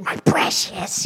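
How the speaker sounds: unvoiced, slow, hissing Gollum whisper; Gollum impression. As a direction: clutch the keys like Gollum, whispering. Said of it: "little sweet child to Gollum".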